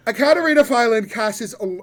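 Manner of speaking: loud, high words through laughter; laughing. Real, not acted. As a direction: laugh while trying to narrate. DM laughing at the roll.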